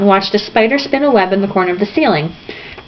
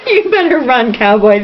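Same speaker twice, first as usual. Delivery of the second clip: yelled taunt; 3 semitones higher than usual. A narrator's reading. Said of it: "the younger Delancey yelled".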